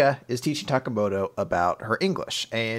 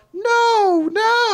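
high, louder mock mom; 5 dB louder than usual. Mom refusing her daughter, high mock voice.

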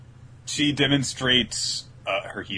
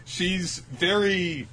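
usual speaking voice

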